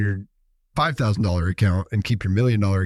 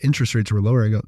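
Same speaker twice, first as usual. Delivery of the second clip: ordinary talk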